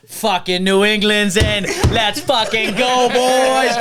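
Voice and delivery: loud, high, drawn-out Boston fan cheer; rally the boys in a hammed-up Boston accent; Jerry. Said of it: "He goes".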